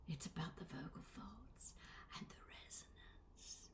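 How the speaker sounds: hushed, unvoiced whisper. Shown as a demonstration. Voice coach explaining vocal folds to learners, whispered. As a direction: whisper it, barely audible.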